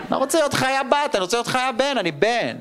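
put-on high, whiny little boy protest; whiny kid. Little boy protesting to mom about his costume, high whine. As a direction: whine like a five year old refusing.

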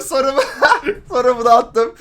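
breaking into laughter; words through a laugh. Real, not acted. Laughing at own message.

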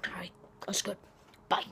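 soft unvoiced whisper; hushed sign-off whisper. Real, not acted.

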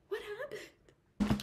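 high, slow, drawn-out disbelief; a reader fed up with the book's characters; exasperated outburst. Real, not acted. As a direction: stretch it out in baffled frustration. Repeats the question, exasperated.

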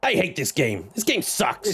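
high-pitched mock gamer rant; hammed-up hatred of a game; Blighted impression. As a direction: complain in a put-on high voice. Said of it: "That's my best blighted".

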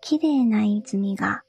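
ordinary delivery

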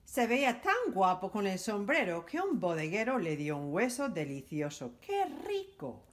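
usual speaking voice

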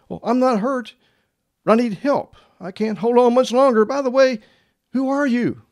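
high-pitched, urgent pleading voice; man hanging on. Acted. Hanging man calling up to the unknown voice, high and urgent. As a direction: play the dangling man calling up, pitched well up. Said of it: "He began to yell for help".

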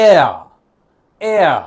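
এয়া stretched and gliding; teaching the drawn-out Danish R. Shown as a demonstration. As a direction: pull এয়া long, with a drawl. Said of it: "এইটা একটু টেনে বলবেন".

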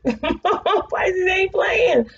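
high, slow laugh through the words. Real, not acted. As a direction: crack up while saying it. Laughing at the card.

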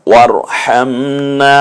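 ওয়ারহামনা with the final না drawn out long; stretched ending. Shown as a demonstration. Teacher shows the long final vowel after explaining it. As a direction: pull out the last vowel of ওয়ারহামনা. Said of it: "শেষে একটু টানতে হবে".

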